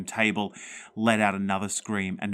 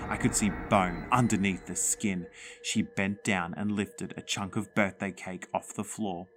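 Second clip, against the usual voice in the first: ordinary delivery, nothing marked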